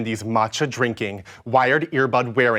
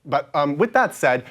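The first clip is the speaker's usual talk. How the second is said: ordinary delivery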